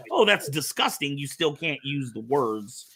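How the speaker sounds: usual speaking voice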